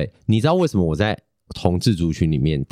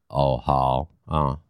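flat indifferent reply; 8 semitones lower, much slower than usual. Retold or imitated. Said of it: "我就这样".

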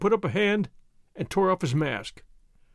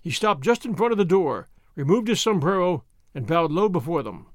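ordinary delivery

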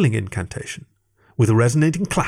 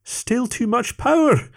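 lamenting; 13 semitones higher, slower than usual. A narrator's reading. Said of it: "lamented Antoine".

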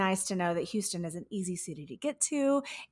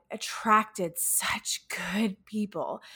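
whispered; much slower than usual. Real, not acted. Grateful aside.

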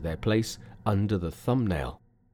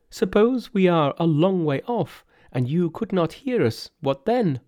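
high-pitched, pleading monks; 7 semitones higher, 4 dB louder than usual. Acted. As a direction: plead high as anxious followers to their protector. Priests object to Monkey.

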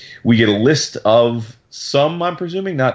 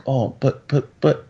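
lightly imitated dejected stammer; halting, helpless little protest; mock sad; 3 semitones higher than usual. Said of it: "she's all sad".

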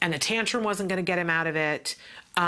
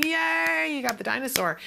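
high cheer on yay; 9 semitones higher than usual. Retold or imitated. Parents joining in, a high cheer. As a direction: celebrate with the child. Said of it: "we were going".

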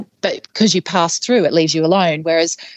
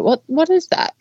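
retold startled question, pitched much higher; alarmed question; 10 semitones higher than usual. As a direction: imitate someone suddenly puzzled, voice rising at the end. Retells companion spotting eyes in bushes.

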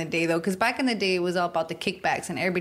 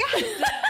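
shrieking laugh, much higher; high burst of laughter. Real, not acted. Ex is watching joke.

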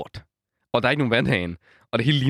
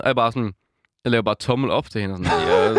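usual speaking voice

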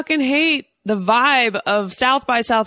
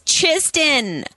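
put-on Valley Girl voice=103; 6 semitones higher than usual. Shown as a demonstration. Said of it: "goes so much better with that voice".

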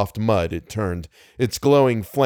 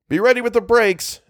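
high-pitched urgent order; shouted warning; 12 semitones higher than usual. A narrator's reading. Algernon readies the driver to brake, shouting. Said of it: "Algernon shouted back".

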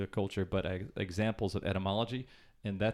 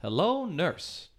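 vaudeville leer; 7 semitones higher, much slower than usual. Retold or imitated. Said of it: "there is a phrase".

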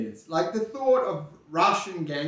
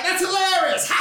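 higher, fawning mock of a guy forcing a laugh; fake laugher; 10 semitones higher than usual. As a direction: mimic a friend faking amusement, pitched up. Imitating a guy who laughs at everything.

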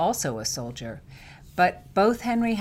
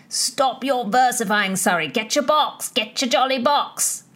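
higher, louder taunting voice of a rowdy soldier; teasing drunk soldier; 5 semitones higher, 6 dB louder than usual. Acted. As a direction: taunt him while dodging round the room. Drunk Wyatt taunting his friend.

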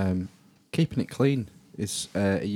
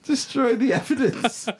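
laughing. Real, not acted. Joking follow-up.

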